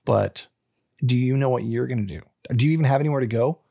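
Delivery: ordinary delivery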